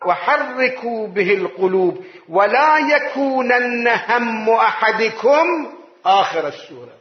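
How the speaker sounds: slow, weighty reading; slow emphatic quote. Shown as a demonstration. Preacher urging to pause at the wonders, slow. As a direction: dwell on every word. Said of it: "بتأني".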